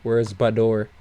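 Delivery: ordinary delivery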